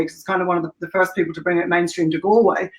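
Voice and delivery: ordinary talk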